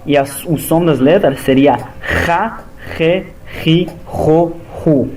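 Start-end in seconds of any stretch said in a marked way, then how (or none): 2.3-5.1 "Ra", "Re" with a harsh throat H, like German ch; guttural H syllables. Shown as a demonstration. Teacher demonstrating Het and Raf sounds to students. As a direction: scrape each "Ru" from the back of the throat. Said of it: "Como se fosse H gutural".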